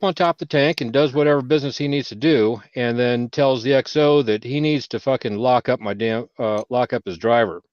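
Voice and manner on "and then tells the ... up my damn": normal talking voice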